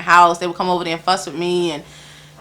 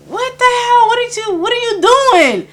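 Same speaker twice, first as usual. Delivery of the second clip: high, incredulous retold "what are you doing"; replaying her own disbelief, pitched up; shocked outburst; 13 semitones higher than usual. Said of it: "So I'm like".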